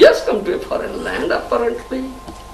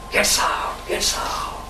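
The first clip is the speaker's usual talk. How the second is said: whispered repeat; 7 dB quieter, slower than usual. Acted. Preacher whispering how the devotee goes out. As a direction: whisper it, fading away.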